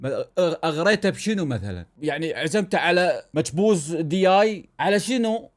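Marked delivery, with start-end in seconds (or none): none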